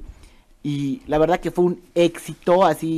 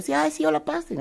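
high, embarrassed quick welcome; flustered high welcome; 9 semitones higher, 6 dB quieter than usual. Retold or imitated. Caught in pajamas at the door, flustered high welcome. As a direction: caught in pajamas, greeting the producers. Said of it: "y yo".

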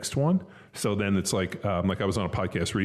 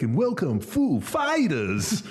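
very slow, high stretched Walken announcement; hammed-up Walken welcome; Christopher Walken impression; 8 semitones higher, much slower than usual. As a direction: drawl the band name out like Walken. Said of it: "amazing Christopher Walken impression".

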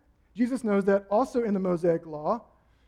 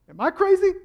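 loud challenge; 11 semitones higher, 9 dB louder than usual. Acted. Jesus challenging the Pharisees.

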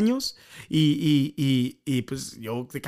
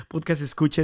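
usual speaking voice

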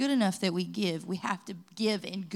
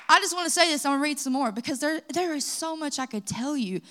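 usual speaking voice

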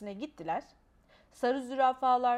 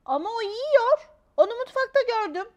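lightly voiced insistent child; 10 semitones higher, 10 dB louder than usual. A narrator's reading. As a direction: insist like a small girl. (hafifçe seslendirilmiş ısrarcı çocuk)

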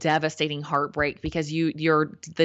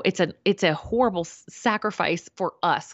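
ordinary talk

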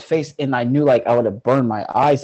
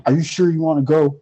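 quick, louder, higher challenge; stern voice; 6 semitones higher, 5 dB louder, faster than usual. Retold or imitated. Angel questions the frightened woman, imitated stern voice. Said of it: "Like, in a stern voice".

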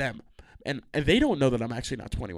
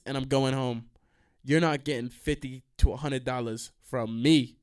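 ordinary talk, nothing marked

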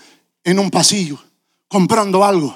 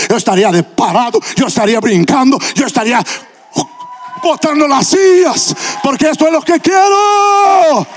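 rising, faster and then high shouted climax; building excitement; 7 semitones higher than usual. Acted. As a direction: push it up to a cry.

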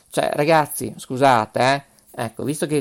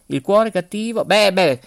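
ordinary talk, nothing marked

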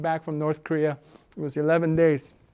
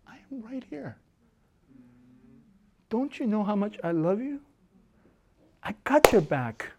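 tender; 6 dB quieter than usual. Acted. God's voice quoted tenderly.

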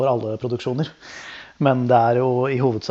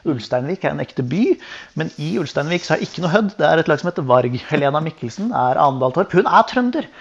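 mock-earnest rundown of facts, rising in pitch; insistent explaining; 3 semitones higher, 3 dB louder than usual. Explaining to the Norwegian people that this is real, insistently. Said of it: "forklare det norske folk at dette er ekte".

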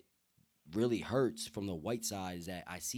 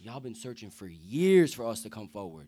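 much louder, pressing the point home; louder emphatic repeat; 9 dB louder than usual. Real, not acted. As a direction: hit it hard the second time round.

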